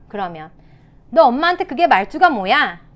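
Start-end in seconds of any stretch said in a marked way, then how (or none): 1.1-2.8 louder, higher mock telling-off; scolding mother. Shown as a demonstration. Mother quarrelling with her rude child, acted scolding. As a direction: act an indignant mom scolding. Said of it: "아이랑 싸움이 났어요".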